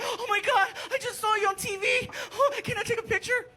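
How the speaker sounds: put-on starstruck kid. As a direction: gush like a star-struck boy meeting his hero. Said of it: "he's all, you know, like".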